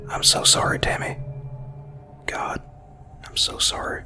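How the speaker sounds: broken, tearful whisper. Acted. Grandma hugging the boy tight, whispering her apology. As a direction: breathe the apology, barely voiced.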